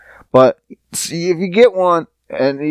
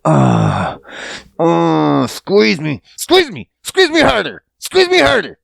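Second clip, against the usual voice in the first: mock begging; 3 semitones lower than usual. Host mocks himself pleading to be cuddled, hammed-up begging. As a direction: beg to be held, breathy then squeaky. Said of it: "over here begging for".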